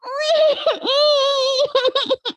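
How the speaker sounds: high, loud hammed-up wail; Baby Bear bursts into loud fake crying; mock crying. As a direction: howl like a tearful cub. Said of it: "Baby Bear started crying again".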